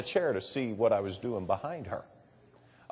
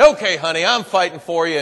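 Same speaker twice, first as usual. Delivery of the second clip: gruffer dad; 3 semitones higher, 8 dB louder than usual. Retold or imitated. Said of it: "be a little gruffer with her".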